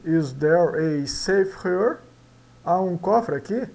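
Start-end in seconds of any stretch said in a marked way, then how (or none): 0.0-2.0 slow, "Is there a safe here?" rising; slow model sentence. Shown as a demonstration. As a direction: say it slowly for learners to repeat. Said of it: "Vamos em frente".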